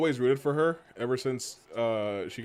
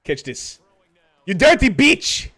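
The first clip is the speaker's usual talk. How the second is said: loud, high Scottish yell; mock Scottish trash-talk shout; Scottish accent; 9 semitones higher, 12 dB louder, slower than usual. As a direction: hurl the insult in a Scottish accent. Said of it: "Bald and Scottish".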